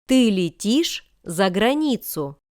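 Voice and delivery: ordinary talk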